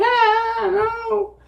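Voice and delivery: squeaky falsetto cry of pain; imitating his own pained screech; high screech. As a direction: squeal it way up high like you've just been hit. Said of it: "my voice was probably a lot higher and I was screeching".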